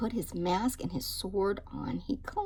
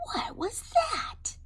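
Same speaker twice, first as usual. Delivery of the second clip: much higher, slowed alarmed question; startled; 14 semitones higher than usual. Acted. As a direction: ask it wide-eyed and startled. Kitten startled by mirror.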